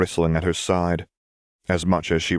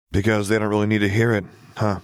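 ordinary delivery

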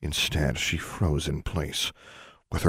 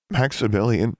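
mildly choked, higher-pitched name; 6 semitones higher, 4 dB louder than usual. A narrator's reading. Girl asking her father after her brother, choked. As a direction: catch a little on the name. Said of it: "she choked".

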